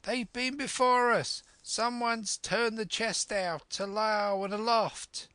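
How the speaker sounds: high, urgent call from above; high-pitched, put-on sailor's cry; crying out news. A narrator's reading. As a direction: call the bad news down from a window. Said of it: "he cried".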